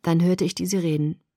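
ordinary delivery

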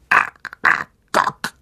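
slow, unvoiced clicking spider call; drawn-out, breathy creature cry. A narrator's reading. Spider calls for its master, slow creature voice. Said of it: "rief sie".

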